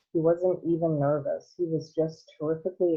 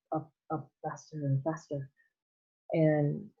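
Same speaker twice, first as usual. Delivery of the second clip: plain reading voice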